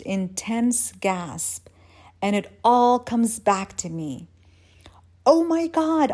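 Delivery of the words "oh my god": high, loud shocked exclamation; pitched-up, contained scream; mock scream. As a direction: cry out in sudden horror. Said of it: "I scream".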